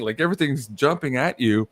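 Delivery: ordinary talk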